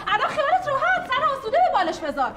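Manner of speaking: high, laughing sarcasm; bitter mocking laugh. Wife taunting her sister-in-law, laughing sarcastically. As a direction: laugh the words at her in spite.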